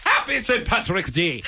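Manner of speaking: high, far-off put-on Irish girl voice; mock Irish woman. Host pushed by co-hosts to do an Irish accent, high and giggling. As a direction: laugh through a girly Irish accent. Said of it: "like an Irish chick".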